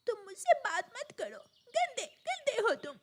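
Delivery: put-on high, whiny child-bird voice; hurt sulking. Injured sister refusing her brother, sulking. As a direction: push him away, wounded and cross.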